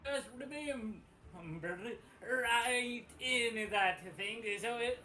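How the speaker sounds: put-on thick incomprehensible southern accent. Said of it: "yells in a really southern accent".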